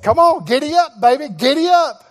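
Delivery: rally the friend on like a rider; excited friend. Retold or imitated. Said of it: "He was just like me".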